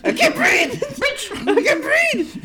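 very high, strained mock scream; retold inner screaming. Man trying to move, screaming at wife in his head, squealed. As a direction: squeal it in hammed-up panic. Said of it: "you were screaming".

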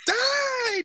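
high, shrill-pitched yell of "Die"; mock battle cry. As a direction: scream it like you're blasting away in a game. Acting out firing shotgun.

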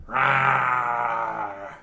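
low growled roar; dinosaur voice. Acted. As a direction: growl like a hidden beast. GM makes monster noise.

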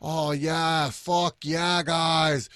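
a lousy mock Boston accent; jokey fake Boston bro accent; bad Boston accent. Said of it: "That was a horrible Boston accent".

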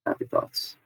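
very low, soft murmur; barely voiced, low and quiet; hushed soothing mantra. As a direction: murmur it softly under your breath. Repeats soothing thought under breath.

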